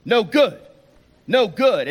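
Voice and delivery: half-shouted re-enactment; imitated yelling. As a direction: repeat it like someone yelling on a bus. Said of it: "starts yelling".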